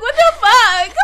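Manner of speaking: high, loud squealed disbelief; loud incredulous squeal; mock outrage. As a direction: shriek it in fake shock. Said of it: "and I'm like".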